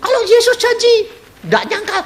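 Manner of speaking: preacher mocking the congregation's glib promise, squeaky and high; smug churchgoer. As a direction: mimic the congregation's easy confidence. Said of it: "kamu sekarang ... bilang".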